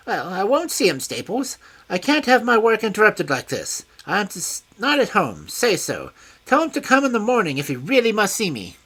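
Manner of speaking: high, loud, fussy complaint; a peevish scholar snapping at his butler; high piercing petulant man. A narrator's reading. Culverton Smith refusing the visitor.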